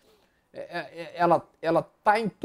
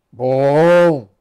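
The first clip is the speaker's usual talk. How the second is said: loud approval; 4 semitones lower, 13 dB louder than usual. Real, not acted. Approving a good chart.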